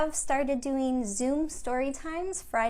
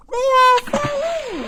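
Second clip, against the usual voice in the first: much higher and louder playful cry; gleeful shout at the finale; excited exclamation; 13 semitones higher, 13 dB louder than usual. Acted. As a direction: make the big splash ending exciting. Rhyme climax, elephants fall.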